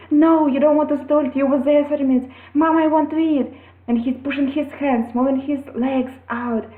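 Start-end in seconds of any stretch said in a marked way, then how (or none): 0.1-2.3 higher, louder, fast no-nonsense mother; firm mother. Retold or imitated. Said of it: "I said". 2.5-3.5 put-on higher, louder child's whine; child excuse.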